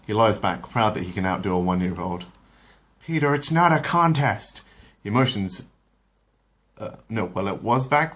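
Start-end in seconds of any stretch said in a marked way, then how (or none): 3.0-4.6 higher, exasperated wife; Lois scolding. Acted. Lois scolds her husband for bragging, higher and exasperated. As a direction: roll your eyes at him.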